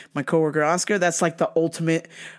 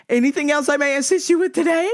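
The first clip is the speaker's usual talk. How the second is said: high, sugary service voice; overly courteous retail worker, pitched up; 10 semitones higher, 3 dB louder than usual. Retold or imitated.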